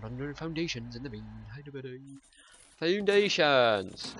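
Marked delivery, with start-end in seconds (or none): 2.8-4.1 loud drawn-out shout. Real, not acted. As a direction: stretch the word out, slow and loud. Shouting back at game instruction.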